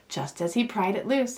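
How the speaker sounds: ordinary delivery, nothing marked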